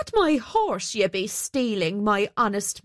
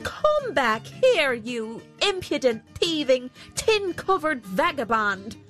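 high, drawn-out shout after a thief; angry miller's high-pitched insults; indignant calling out; 6 semitones higher than usual. A narrator's reading. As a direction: call after him in acted outrage. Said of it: "called the miller".

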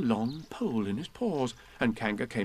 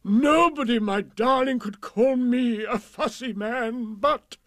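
high, loud, drawn-out proclamation; hammed-up happy monarch; triumphant king; 11 semitones higher, 5 dB louder, slower than usual. A narrator's reading. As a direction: declare it with jubilant grandeur. Delighted King proclaims to the Queen.

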